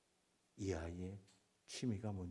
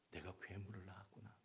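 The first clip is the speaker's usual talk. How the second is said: normal talking voice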